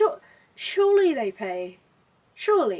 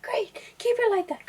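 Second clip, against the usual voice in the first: high breathy coaxing whisper; whispering photographer; 12 semitones higher than usual. Retold or imitated. Photographer murmuring encouragement mid shoot, high whisper. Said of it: "the photographer's like".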